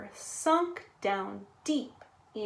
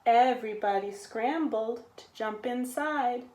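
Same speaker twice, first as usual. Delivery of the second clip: usual speaking voice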